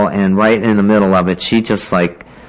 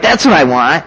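high, excited retold plea; mock-naive enthusiasm, pitched up; eager insistence; 7 semitones higher, 3 dB louder than usual. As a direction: insist like a thrilled newcomer.

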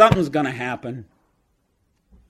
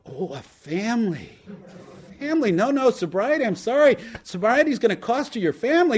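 high, falsely sorry God; mock-sorry God; 9 semitones higher than usual. God adding the family to the bill, high and mock sorry. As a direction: apologize sweetly while taking everything. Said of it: "And God goes".

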